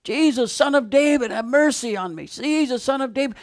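high desperate repeated plea; crying out. Acted. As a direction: call over the crowd, pitched high. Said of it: "kept on crying it out".

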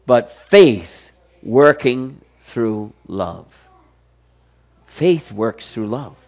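very slow, deliberate words; weighty pronouncement; slow emphatic. Acted. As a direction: linger on each word of "faith works through love". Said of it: "emphatic slow statement".

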